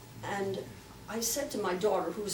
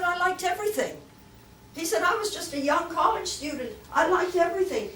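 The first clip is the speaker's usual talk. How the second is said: excited; 9 semitones higher, 7 dB louder than usual. Retold or imitated. Quoting Wong, high loud.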